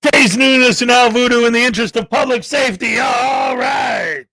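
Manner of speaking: loud, punchy announcer opening; show intro shout. Acted. Host kicks off the episode with a loud announcer shout. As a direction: belt out the show intro like a hype man.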